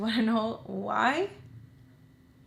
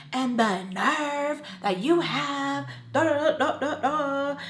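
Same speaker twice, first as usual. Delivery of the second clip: higher, drawn-out mock telling-off; retold angry rant; 6 semitones higher, 3 dB louder, slower than usual. Daughter rehearsing what she'll tell her mom, mock indignant. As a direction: work up a sassy rant that runs into da-da-da. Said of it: "So I was mad".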